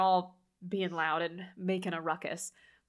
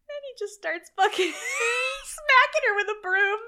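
high, loud words broken by laughter; cracking up while telling it; laughing; 12 semitones higher, 5 dB louder than usual. Real, not acted.

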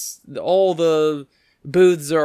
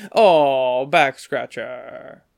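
drawn-out mock letdown; imitated waiter, slow and let down; disappointed waiter; 3 dB louder, much slower than usual. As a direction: sink into a slow, hammy groan of words. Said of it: "And he goes".

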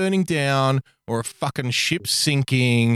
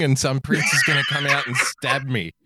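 laughing shout. Real, not acted. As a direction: blurt it through a laugh. Laughing at own joke.

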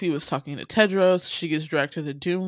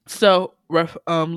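ordinary talk

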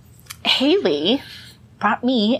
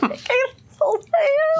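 high squeal. Acted. Echoing the invasion cry.

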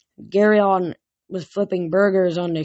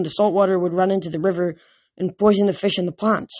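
usual speaking voice

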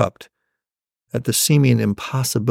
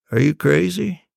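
measured dad voice, slightly raised in pitch; very level voice; 4 semitones higher than usual. Acted. Dad answering his child's question seriously, very level voice. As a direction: deliver it evenly, without outburst. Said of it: "in a very level voice he said".

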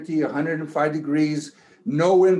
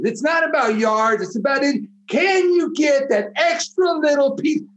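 rising to a fired-up shout, much higher and louder; rally the team like it's fourth and inches; a locker-room speech that builds from firm to roaring; coach's pep talk; 6 semitones higher, 3 dB louder than usual. Pretend. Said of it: "Can you give us your best coach's speech?".

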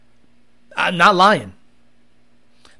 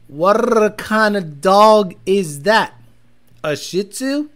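slow replay; 4 dB louder, much slower than usual. Retold or imitated. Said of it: "took me a while to process".

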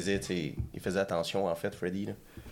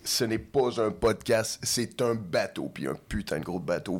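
voix d'animateur qui ouvre l'émission, posée; présente l'émission comme un capitaine; annonceur; slower than usual. Acted. Podcast opening welcome. (ton d'annonceur solennel)

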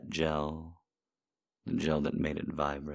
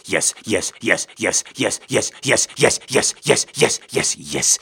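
excited urging; 11 dB louder than usual. Acted. Urging climax, loud chant.

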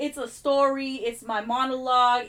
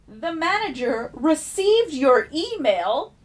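slow, higher, louder prim receptionist voice; mock clerk voice; 4 semitones higher, 6 dB louder, slower than usual. Imitating the hotel clerk to a friend, slow prim customer-service voice. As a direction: parody the clerk's polite brush-off, drawn out.

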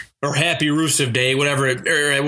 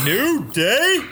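excited echo; 10 semitones higher than usual. Real, not acted. Echoing cohost's call excitedly.

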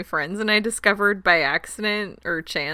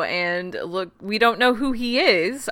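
usual speaking voice

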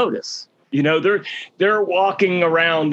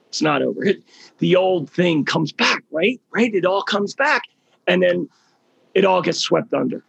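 ordinary talk

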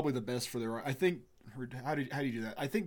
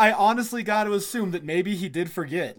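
laughing mock dad; 6 semitones higher, 8 dB louder than usual. Imitating the forgetful dad.

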